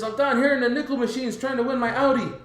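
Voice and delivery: high excited imitation; retold excited answer. Friend at the nickel machines answering, excited. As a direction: imitate an eager gambler on the phone.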